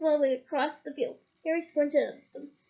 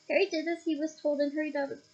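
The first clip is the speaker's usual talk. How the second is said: plain reading voice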